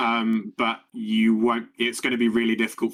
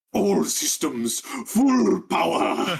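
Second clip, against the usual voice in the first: drawn-out booming villain roar, pitched up; rumbling voice; 8 semitones higher, much slower than usual. Acted. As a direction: bellow it slowly like a machine powering up. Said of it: "lets out a rumbling voice".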